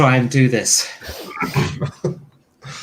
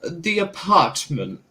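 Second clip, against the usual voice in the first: Margaret Thatcher voice; 8 semitones higher, much slower than usual. Acted. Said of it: "It has the voice of Margaret Thatcher".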